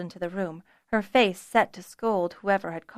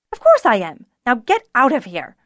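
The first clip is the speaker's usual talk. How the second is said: louder, sharp dismissal; sharp retort; 4 semitones higher, 8 dB louder than usual. A narrator's reading. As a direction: snap them out of the kitchen. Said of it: "responded sharply".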